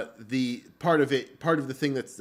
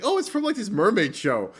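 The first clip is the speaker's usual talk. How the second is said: much higher, louder mock girlfriend; 10 semitones higher, 7 dB louder than usual. Quoting his girlfriend explaining the mermaid show, high mock voice. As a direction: squeak it out like an excited girlfriend.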